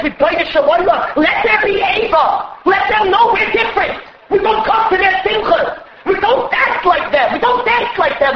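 rising sermon fervour, almost shouted; preaching at full force. Acted. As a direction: thunder it out like a fiery preacher. Fiery quoted rebuke, sermon.

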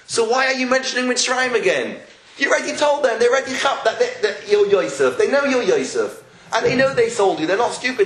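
high, insistent arguing; exasperated challenge. Acted. Teacher takes Yosef to task, exasperated challenge. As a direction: press him as if he should know better.

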